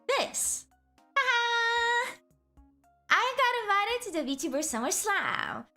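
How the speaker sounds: high, gleeful announcement; excited. Real, not acted. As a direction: burst out with the big news. Announcing the invitation with glee.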